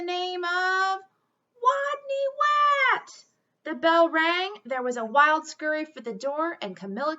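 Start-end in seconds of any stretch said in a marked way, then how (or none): 0.0-3.2 reveal. Acted. Big reveal of the leader.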